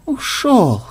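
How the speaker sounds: high, falling croak; croaked disbelief. A narrator's reading. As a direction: echo the news, stricken. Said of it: "всхрипнул программист".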